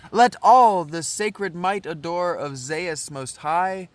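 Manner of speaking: ceremonial reading of an ancient prayer; declaimed verse. Acted. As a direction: intone each phrase like a chorus praying.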